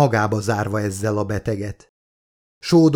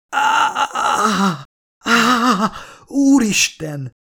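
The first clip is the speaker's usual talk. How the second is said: screaming in pain; 11 semitones higher, much slower than usual. A narrator's reading. Woman screams at the surgery machine cutting her open in pain. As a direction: cry out as the blade cuts you. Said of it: "ordította a nő fájdalmában".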